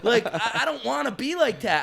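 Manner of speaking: ordinary talk, nothing marked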